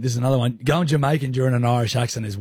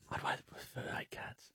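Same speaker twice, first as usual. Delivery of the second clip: barely audible whisper; muttered aside; 22 dB quieter than usual. Real, not acted. Co-host answering the koala claim under his breath, whispered.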